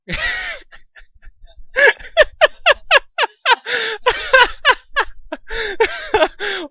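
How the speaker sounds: long burst of real high-pitched laughing; helpless high laughter. Real, not acted. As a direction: break down laughing at the text. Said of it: "Well, this is going".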